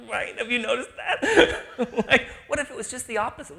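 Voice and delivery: high, loud words bubbling with real laughter; laughing. Real, not acted. Laughing at the joke she just told. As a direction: laugh as you ask it. Laughing through her own point.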